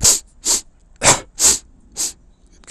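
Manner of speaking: loud, barking fake coughs; big mock allergy hacking; allergy coughing fit. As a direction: cough hard and repeatedly, hammed up. Acting goddess with terrible allergy.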